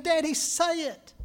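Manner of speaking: put-on a little boy begging his daddy; pleading child. Boy asks once more.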